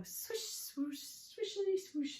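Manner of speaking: high, airy swishing chant; broom setting off. Acted. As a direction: swish along before speeding up.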